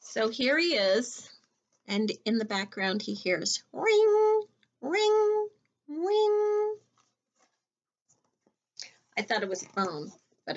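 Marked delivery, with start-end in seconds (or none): 3.7-6.8 high, sing-song ring ring; doorbell ring. Pretend. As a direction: chime it like a doorbell. Doorbell sound in the story.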